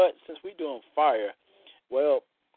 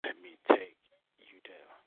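sultry poem reading; 6 semitones lower, 6 dB quieter than usual. Acted. Reads his erotic poem.

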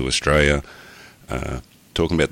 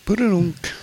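sung rimshot; slower than usual. Acted.

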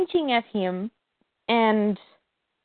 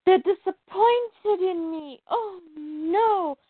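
higher, slow, hammed-up tearful whimper; mock whimper; 7 semitones higher, slower than usual. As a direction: fake crying over her parents' disappointment. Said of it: "So then she's like".

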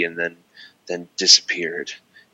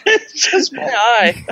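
laughing. Real, not acted. Laughing at own camera mishap.

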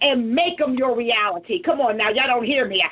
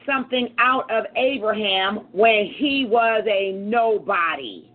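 slow deliberate reading; 3 semitones lower than usual. Shown as a demonstration. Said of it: "I read out the message".

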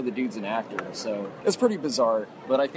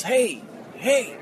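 imitated yell; 8 semitones higher than usual. A stranger yells across the hotel bar, mild mock yell. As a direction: call out like a heckler, held back. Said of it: "someone yells".

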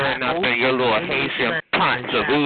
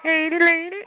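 calling out; 14 semitones higher than usual. Acted. Calling out to caller.

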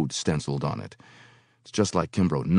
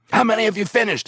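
higher, louder boss question; demanding boss; 5 dB louder, faster than usual. Acted. Foreman checking the worker's progress, impatient. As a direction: press him like an impatient foreman. Kimbrough voiced.

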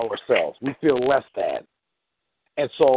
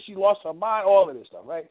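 normal talking voice

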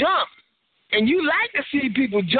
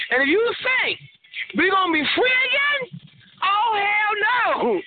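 mock incredulous; 10 semitones higher than usual. Warning listeners they won't be free, rising higher. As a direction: pitch climbing much higher, slow hell no.

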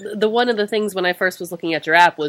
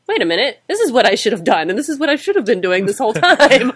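rising, louder mock epiphany; excited realization; 5 semitones higher, 6 dB louder than usual. Telling hosts about finding his calling, in excited realization. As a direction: act out the sudden wait-a-minute discovery.

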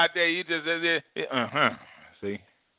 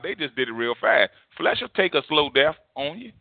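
ordinary talk

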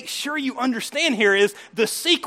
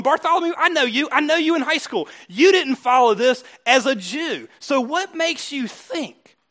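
higher, emphatic calling-out of a peer; acted challenge; 5 semitones higher, 4 dB louder than usual. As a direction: confront them pointedly, slowing down at the end. Acting out Peter challenging his listeners.

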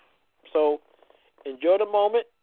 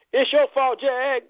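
mock accusation; 7 semitones higher, much slower than usual. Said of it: "all you heard for the last few years was".

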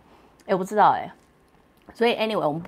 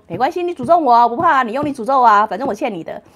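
louder, higher mock defiance; defiant dare; 4 semitones higher, 8 dB louder, faster than usual. B daring angry A to curse him, loud mock taunt. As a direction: taunt her to curse you. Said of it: "B说".

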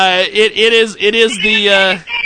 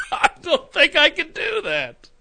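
laughing; 12 semitones higher, 5 dB quieter than usual. Real, not acted. Laughing at own idea.

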